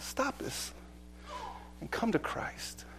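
soft breathy plea; hushed plea. Acted. Preacher voicing Jesus, pleading softly. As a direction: draw them in, nearly breathless. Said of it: "He says".

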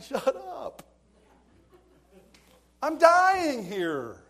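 high, loud, drawn-out desperate cry; exasperated outburst. Retold or imitated. Embarrassed teen silently begging the pastor, exasperated yell. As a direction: wail it out in mock agony. Inner outburst when put on the spot.

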